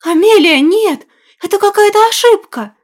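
desperate cry. Acted. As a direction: cry out in despair. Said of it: "под трагические возгласы сестры".

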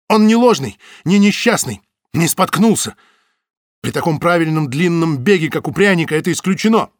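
high, loud and agitated. Acted. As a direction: press them, worked up. Butovich argues excitedly.

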